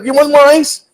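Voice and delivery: breezy high nurse offering ice. Retold or imitated. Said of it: "I go".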